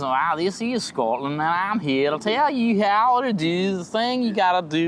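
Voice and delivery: deliberately bad, lilting Scottish accent; terrible Scottish accent. Comic introducing Scotland to co-host, badly faked Scottish accent. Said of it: "the accent so bad".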